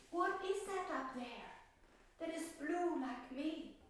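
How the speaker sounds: a hint of a curious little violet; small violet asking. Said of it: "And the violet said".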